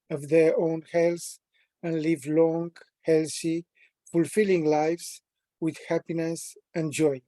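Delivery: ordinary talk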